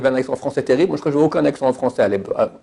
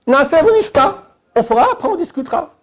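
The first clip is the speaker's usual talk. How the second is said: acted Israelites' reply; 12 semitones higher than usual. Voicing Israelites accepting Torah.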